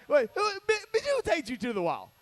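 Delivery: high, loud disbelieving shout; mock shocked yell. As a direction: act stunned at the sight on the wall. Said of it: "You're like".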